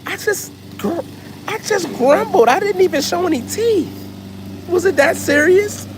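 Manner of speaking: high, squeaky put-on dog voice; dog's indignant voice. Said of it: "She's like".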